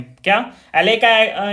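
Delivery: ordinary talk, nothing marked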